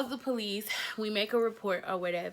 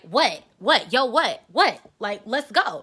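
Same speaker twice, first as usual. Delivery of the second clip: challenging; 8 dB louder, slower than usual. Retold or imitated. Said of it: "She was like".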